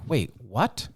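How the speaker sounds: baffled, as if waking up in another world; retelling his shock at his girlfriend's sudden turn; incredulous disbelief. Retold or imitated. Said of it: "And I'm like".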